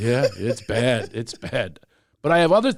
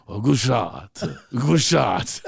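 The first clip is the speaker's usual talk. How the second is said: imitated Japanese-accented English; affectionate mimicry of a Japanese father-in-law; father-in-law's Japanese accent; much slower than usual. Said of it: "This is what he sounds like".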